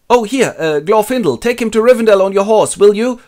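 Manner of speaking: high, loud acted request; calling out. Aragorn sends elf off with Frodo, high loud call. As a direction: call urgently to a passing rider. Calls on arriving Elf Lord.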